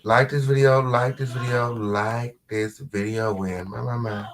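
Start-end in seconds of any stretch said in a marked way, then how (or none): none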